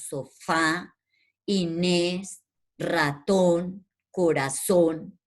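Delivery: last syllable stressed in "sofá, Inés, ratón, corazón"; teacher's word list, each of "sofá, Inés, ratón, corazón" weighted on its ending; final-syllable stress. Shown as a demonstration. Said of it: "lleva en la última sílaba".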